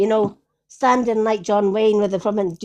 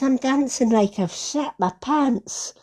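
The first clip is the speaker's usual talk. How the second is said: slower, lightly voiced distressed woman; quoting a worried friend, a bit slower; quoting her; 5 semitones higher, slower than usual. Friend retelling a pal's worry, mildly voiced.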